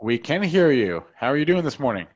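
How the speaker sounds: ordinary talk, nothing marked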